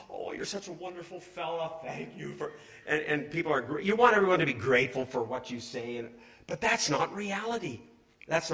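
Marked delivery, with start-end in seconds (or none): 0.0-2.5 soft, gasping mock gratitude; gushing admirer. A dreamed-of listener thanking the preacher, lightly gushing. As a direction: coo a light send-up of a fan. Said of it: "human nature is you want everyone to like you".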